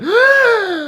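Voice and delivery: sharp, very high, loud gasp of horror; shocked gasp. Retold or imitated. Recalling the moment he saw his split shin, a loud horrified gasp. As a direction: gasp like you just saw bone.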